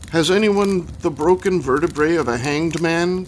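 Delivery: lightly voiced Hungarian caller; Hungarian accent. A narrator's reading. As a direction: call out as a Hungarian man. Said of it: "came a deep masculine voice with a Hungarian accent".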